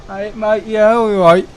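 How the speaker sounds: imitation Birmingham local; mock Birmingham accent. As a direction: put on a Brummie accent. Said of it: "I don't like that accent".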